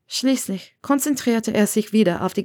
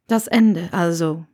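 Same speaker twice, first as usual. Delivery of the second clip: Severus; 3 dB louder than usual. Acted. Severus repeats it, low voice.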